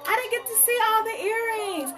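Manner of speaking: high-pitched mock complaint; imitating complaining viewers. Host voicing viewers who missed the earrings, mock complaint. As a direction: put on a high, pleading customer voice. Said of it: "some of y'all is like".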